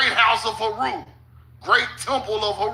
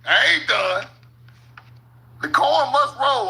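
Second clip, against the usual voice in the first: normal talking voice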